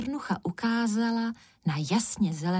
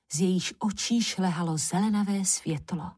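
ordinary delivery

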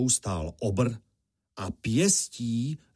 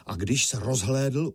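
ordinary delivery, nothing marked

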